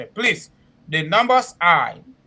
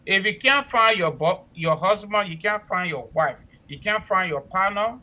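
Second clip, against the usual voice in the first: normal talking voice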